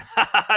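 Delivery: high, loud mocking giggle. Two pranksters giggling at the frog, high mock giggle. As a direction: giggle high like a cheeky little creature. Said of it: "like, giggling".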